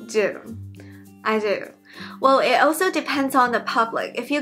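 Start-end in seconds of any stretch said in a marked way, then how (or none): none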